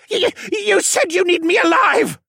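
very high, loud, stuttered plea; stammering, begging. A narrator's reading. Assassin begs the dragon for his life, panicked stammer. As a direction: beg frantically, tripping over the first word. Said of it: "he begged".

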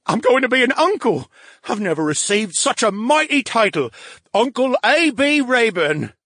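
high, loud, grandly proud brain voice; savoring his new title. Acted.

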